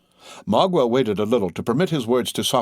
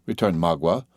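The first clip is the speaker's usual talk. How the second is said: ordinary delivery, nothing marked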